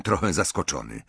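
plain reading voice

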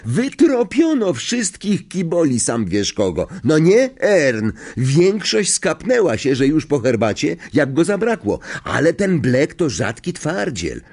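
high, animated storytelling in character; gossipy conductor. Acted. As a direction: spin the lurid tale with relish.

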